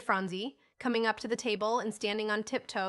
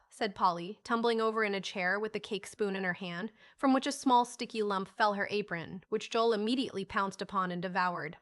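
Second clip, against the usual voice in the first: usual speaking voice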